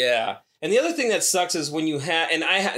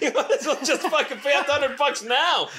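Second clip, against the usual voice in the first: laughing through the words, pitched up; cracking up mid-sentence at the joke; 9 semitones higher than usual. Real, not acted. Joking to co-host about airport prices, laughing.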